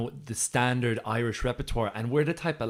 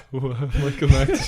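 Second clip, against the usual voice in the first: slow laughing name; 2 semitones higher, 7 dB louder, much slower than usual. Real, not acted. Laughing over alliterative name.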